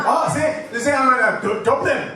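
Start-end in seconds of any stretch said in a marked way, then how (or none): 1.4-2.0 loud teasing imitation of an Irish accent; mock Irish accent. Comic mimicking the audience member from Dublin, mock Irish accent. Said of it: "I have a fucking accent".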